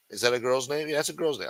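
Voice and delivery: usual speaking voice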